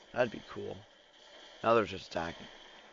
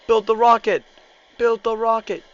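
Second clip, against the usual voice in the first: shouted order; 13 semitones higher, 16 dB louder than usual. Pretend. Urging troops.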